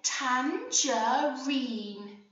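put-on "tangerine" stretched very slowly for children to copy; drawn-out model word. Shown as a demonstration. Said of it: "Can you say".

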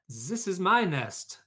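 slow, loud, high insistent claim; possessive duck. Acted. Duck fights the goose for the nest, loud insistent. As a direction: stake the claim firmly. Birds quarrel over nest.

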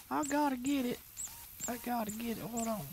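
very high, held sing-song falsetto; playful falsetto. Pretend. Gamer eager to grab the picture, playful falsetto. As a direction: jump far up into a silly high voice.

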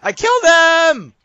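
high, loud cry of disbelief; real excited outburst; triumphant shout. Real, not acted. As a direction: yell it in shocked triumph. Sudden in-game kill.

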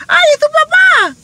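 high squealy mock exclaim; gushing busybody. Tactless woman squealing at a stranger.

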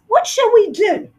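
high, fretting mouse wife; worried wife. Acted. Dentist's wife worrying aloud, high and anxious. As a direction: fret over the poor fox.

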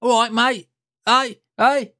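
lightly mocking cheeky Brit; a hint of a pub Brit. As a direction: toss it off like a cliché Londoner. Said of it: "they always do that stupid one".

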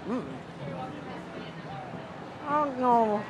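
talking between bites; chewing. Real, not acted. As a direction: mouth full of fish. Seen 2: Puts tuna in mouth at -2, closed-mouth chewing through early words.

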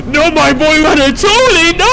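shrieking gamer dismay; high-pitched wailing. Real, not acted. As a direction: wail it out in mock horror. Crying out at game mishap.

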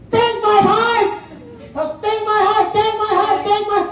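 high repeated hammed-up shouting; shouted offer. Crowd in the kingdom volunteering, repeated high shout. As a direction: keep yelling the offer over and over. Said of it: "may sumigaw".

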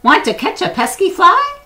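very high, loud squawky rooster voice. Acted. The rooster asks the spider, very high and loud. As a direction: keep the rooster's crow in the words.

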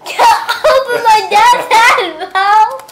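shouting. Real, not acted. Shouting at player on head.

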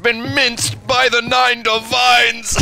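loud, slow, savoring hype; excited shout. Real, not acted. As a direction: roar out the kill like a commentator. Excited hype over kill.

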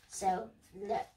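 ordinary delivery, nothing marked

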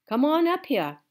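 put-on loud, firm old woman; hard and serious. A narrator's reading. Gloria calls the girl to the porch, hard and serious. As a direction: command her up. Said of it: "in her voice, all hard and serious".

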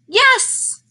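high, exclaimed yes; excited yes. Acted. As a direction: pop the yes up high with delight. Playful answer to silly question.

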